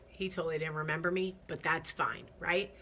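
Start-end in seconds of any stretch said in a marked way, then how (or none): none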